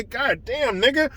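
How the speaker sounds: a hint of the player's casual tone; imitating Schroeder. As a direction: keep the inflection, say it casually and quiet. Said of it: "just like Dennis Schroeder said it".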